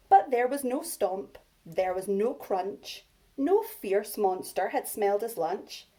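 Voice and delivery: put-on lively read-aloud for young children; story reading. A narrator's reading. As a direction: read it big and bouncy for little ones. Reads picture book to class.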